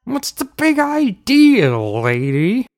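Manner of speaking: high, slow outraged challenge dropping on the last word; indignant uncle. A narrator's reading. Uncle confronts the queen over an insult, indignant.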